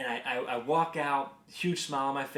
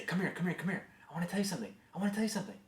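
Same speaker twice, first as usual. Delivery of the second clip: higher, softer urgent beckoning; 6 semitones higher, 3 dB quieter, much faster than usual. Retold or imitated. Dad beckoning his little daughter, playfully urgent. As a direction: urgently call a toddler over to share a secret. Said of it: "I'd make it very urgent feeling".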